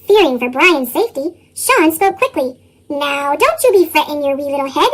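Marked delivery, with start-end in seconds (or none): none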